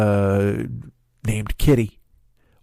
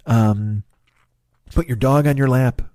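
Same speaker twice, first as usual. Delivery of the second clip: ordinary talk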